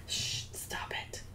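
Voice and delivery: hushed whisper to the dog; breathy whispered shush, no voice at all. Real, not acted. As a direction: quietly shush a snoring pet. Hushing the snoring dog.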